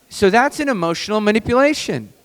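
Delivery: ordinary talk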